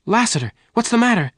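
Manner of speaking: high, fast alarm; crying out. A narrator's reading. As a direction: blurt it out in worried shock. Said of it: "Jane cried".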